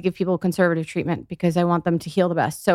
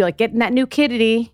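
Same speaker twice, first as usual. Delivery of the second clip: ordinary talk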